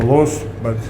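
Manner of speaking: ordinary talk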